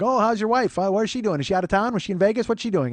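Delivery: high, eager string of questions; imitating friendly curiosity. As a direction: mimic an innocently chummy clerk pestering a friend. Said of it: "He's just a really friendly, nice man".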